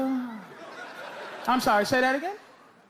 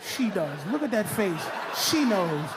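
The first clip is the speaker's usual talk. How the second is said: mock scolding; 11 semitones lower than usual. Mock argument aside.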